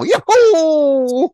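high, loud, drawn-out yahoo; whooping yell. Acted. Joke teller sends the couple up in the plane, whooping yell. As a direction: whoop it out at full volume.

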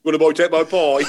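put-on Birmingham accent, loud joke line; hammed-up Brummie quote. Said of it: "And now we're gonna".

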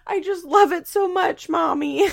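put-on high, loud sweet child voice; Isaac imitated gushing to his mom; little boy voice. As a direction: gush to mommy like a small boy. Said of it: "he goes".

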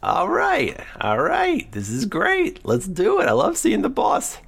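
high, giddy lizard prisoner; excited weasel voice. Acted. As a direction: squeak it out with glee. Dragonborn cellmate talks.

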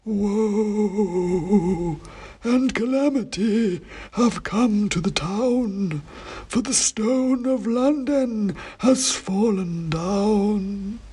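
high, slow, dusty oracle voice; dusty faint ancient head. A narrator's reading. Ancient king's head answering the wizard, slow high dusty chant. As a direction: intone it like a faint, dusty myth. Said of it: "his voice as dusty and faint as myth".

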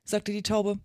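usual speaking voice

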